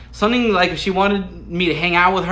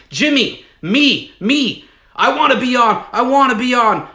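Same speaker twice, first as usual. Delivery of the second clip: higher and louder, begging to be chosen; eager shouting to be picked; 5 semitones higher, 4 dB louder than usual. Retold or imitated. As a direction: wave your hand and plead to get on the show.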